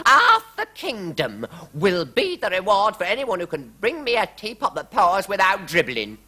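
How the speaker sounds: high, loud royal decree; queen proclaiming. A narrator's reading. Queen announcing a reward to the court, high proclamation. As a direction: declare the reward grandly. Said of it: "cried the Queen".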